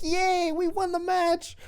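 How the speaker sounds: put-on high, childlike giddy cheer. Tyler Bate celebrating the win, giddy high squeal. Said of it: "Tyler Bate's, like, all giddy".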